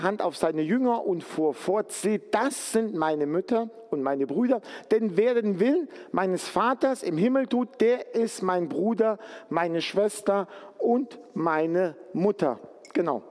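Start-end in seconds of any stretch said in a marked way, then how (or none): none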